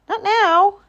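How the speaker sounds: high-pitched animal refusal; squirrel voice. A narrator's reading. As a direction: play an unwilling little squirrel. Squirrel refuses Groundhog's visit.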